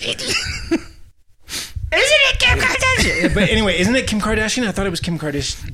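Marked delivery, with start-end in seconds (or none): 0.0-3.3 breathy high falsetto, slower. Shown as a demonstration. Podcaster repeating the question in high voice. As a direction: float it up high and airy. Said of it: "when I do my high voice".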